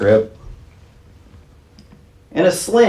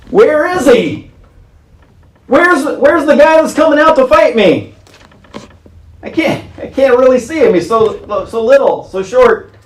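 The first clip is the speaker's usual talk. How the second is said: higher, mocking giant searching; taunting Goliath; 8 semitones higher than usual. Goliath scans the field for David, high and mocking. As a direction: squint and scoff at the tiny foe.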